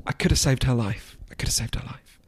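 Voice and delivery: hushed mock grief fading into a whisper; almost crying. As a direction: act choked up, sinking to a whisper. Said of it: "She's almost crying".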